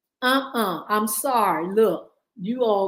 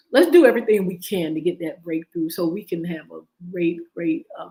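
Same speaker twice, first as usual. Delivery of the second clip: usual speaking voice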